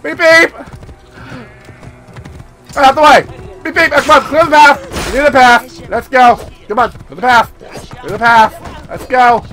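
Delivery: loud high beeping yells; shouted horn calls. Real, not acted. Rider shouting at people to clear the path, loud. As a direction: yell and honk to clear the way.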